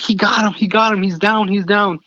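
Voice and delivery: high-pitched thrilled calls; excited yelling. Retold or imitated. Younger son celebrating the shot, high excited yelling. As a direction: yell it high like fireworks just went off. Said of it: "my younger son screaming and yelling".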